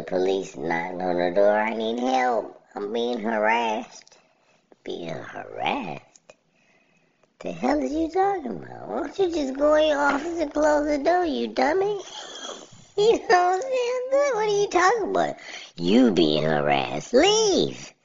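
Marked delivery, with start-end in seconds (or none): 1.6-4.2 higher, slower whiny mock victim; mock plea for help. She tells the officers next door, mock pleading. Caller quoted asking police. 4.8-6.3 slow, whispered disbelief; incredulous whisper. Real, not acted. Repeating her claim to himself, whispered disbelief. 7.4-17.9 much higher, fast outraged squawk; exasperated rant. Real, not acted. Yelling at the woman in the video, outraged.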